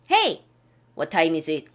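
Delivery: normal talking voice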